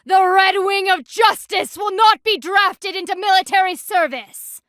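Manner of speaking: high, loud mocking impression of a grandstanding hero; booming, overblown imitation of a self-styled champion; mock pompous hero. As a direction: puff up and parody his lofty declaration. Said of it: "Something about".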